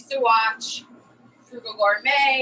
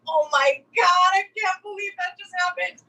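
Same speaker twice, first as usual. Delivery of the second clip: giddy disbelief; 8 semitones higher, 3 dB louder than usual. Retold or imitated. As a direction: squeal it like a starstruck fan. Star-struck after Bobby's curse.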